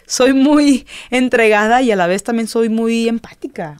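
normal talking voice

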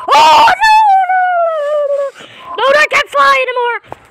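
high, loud cry of alarm fading down; panicked shout. Real, not acted. Gamer panics as his flying robot falls, high loud shout. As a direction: yelp as the robot goes down.

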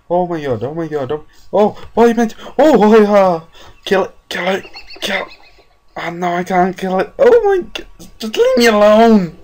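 high panicked shrieks; frantic, desperate pleading; screaming at a spider. Real, not acted. Panicked attacked in game.

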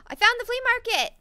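high, loud burst of triumph; excited discovery. Real, not acted. Gamer finally finding the flea market, excited shout. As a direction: exclaim it loudly, thrilled to find it.

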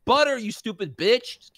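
high mock scream of frustration; exasperated outburst. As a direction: shriek it out like a frazzled waiter. Venting at AVT demands.

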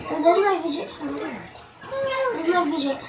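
normal talking voice

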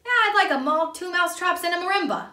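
high, loud caller voice; phone caller character. A narrator's reading. Caller phoning the police with a food order, high and loud. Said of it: "said the person on the other end".

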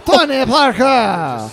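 mock announcer call. Mock announcer calling player name.

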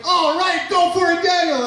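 very high cheerleader voice; mock cheering crowd. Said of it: "And you're all like".

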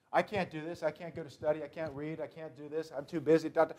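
soft, whiny excuse-making; quiet, half-hearted complaining; mock excuses. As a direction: mutter the excuses like a reluctant believer. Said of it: "excuses why you can't do something".

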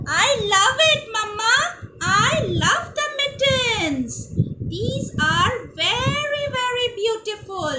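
put-on high-pitched delighted storybook child. Girl thanks her mother for mittens, high delighted voice.

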